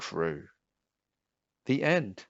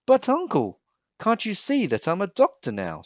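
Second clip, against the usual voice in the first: high, indignant plea; protesting Pearl; 10 semitones higher than usual. Acted. Pearl protests to her uncle the king. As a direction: argue back, hurt and eager.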